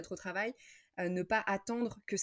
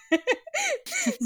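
high laugh; 7 dB louder than usual. Real, not acted. Laughs while telling scooter ride.

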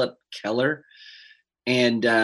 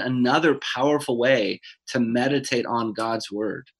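ordinary talk

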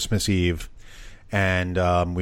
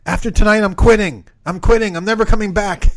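high, loud exasperated waitress; loud quote; 13 semitones higher, 9 dB louder than usual. Retold or imitated. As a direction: blurt it out like she's fed up. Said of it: "basically saying to us".

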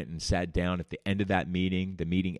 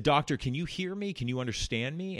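mock condescending; 8 semitones higher than usual. Mocking doctor's hearing.

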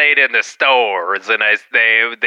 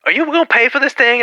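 high-pitched cashier voice; imitated sandwich worker; 11 semitones higher than usual. Sandwich worker asking a customer to pay, imitated high voice. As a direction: raise the pitch to play the server.

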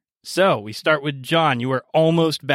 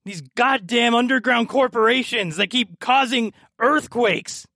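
ranting NPC; 9 semitones higher than usual. Acted. NPC ranting about corporations.

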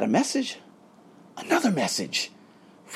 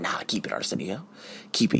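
low, very soft word to himself; quiet, low-key refusal; hushed self-reassurance; 8 semitones lower, 9 dB quieter than usual. Acted. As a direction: talk yourself down under your breath. Talks himself down.

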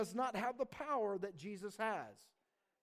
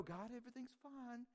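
small, much softer polite prayer; meek pretence; 2 semitones higher, 13 dB quieter than usual. Acted. Believer hiding troubles from God, meekly soft. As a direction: drop to a timid, dutiful murmur.

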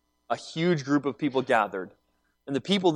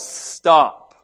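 mild retold shout; 6 dB louder than usual. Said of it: "Jesus yells".

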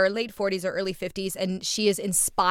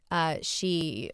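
ordinary talk

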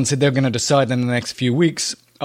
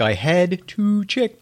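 slow drawn-out; 5 semitones higher, slower than usual. Real, not acted. Drawn-out playful repeat.

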